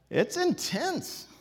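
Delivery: high, drawn-out exclamation; comically intense, high-pitched; strained intensity. Real, not acted. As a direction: squeeze it out high and slow. Jokes that the tones feel intense.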